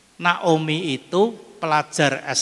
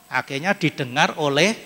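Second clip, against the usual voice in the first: usual speaking voice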